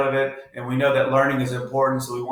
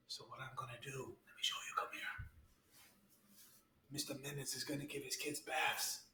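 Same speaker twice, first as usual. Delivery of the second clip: hushed whisper; 3 semitones lower, 13 dB quieter than usual. Acted. Sneaking a prank in the bathroom.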